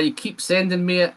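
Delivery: ordinary talk, nothing marked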